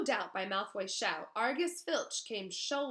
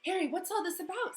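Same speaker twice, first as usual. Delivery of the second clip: high, urgent asking; breathless question; 10 semitones higher, 4 dB louder than usual. Acted. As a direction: ask while catching breath. Ron panting after chase.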